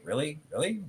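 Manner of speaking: soft, high questioning rise; quietly doubting, voice lifting on each word; sceptical disbelief. Retold or imitated. Parent doubting the student's pick, softly incredulous.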